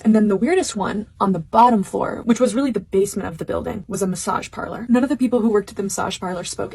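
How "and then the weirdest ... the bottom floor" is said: usual speaking voice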